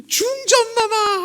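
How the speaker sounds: very high, loud, drawn-out cry; calling out. Retold or imitated. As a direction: call across the street to the queen. Official runs calling queen.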